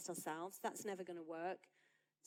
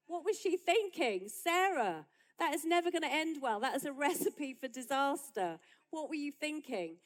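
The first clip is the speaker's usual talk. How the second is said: higher and louder, calling out in disbelief; exasperated exclaiming; 5 semitones higher, 8 dB louder than usual. Acted. Preacher scolding Sarah's bad idea to the ladies, exasperated and loud. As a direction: throw up your hands at Sarah, louder and louder.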